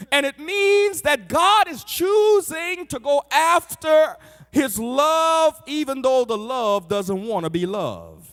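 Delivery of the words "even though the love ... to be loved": usual speaking voice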